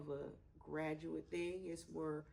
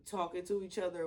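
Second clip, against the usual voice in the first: ordinary talk, nothing marked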